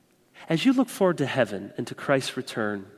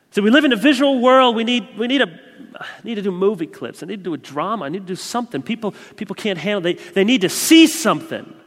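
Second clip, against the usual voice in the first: much higher and louder, fast mock complaint; mock exasperated preacher; 8 semitones higher, 7 dB louder, faster than usual. Preacher mimicking those who want movie clips, mock exasperation. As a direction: ham up the fretting preacher. Mocking the crowd's demand.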